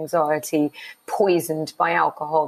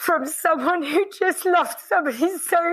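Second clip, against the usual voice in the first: real tears welling up; about to cry; 11 semitones higher than usual. Real, not acted. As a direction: voice high and tearful, on the edge of tears. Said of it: "Oh God, I'm gonna cry".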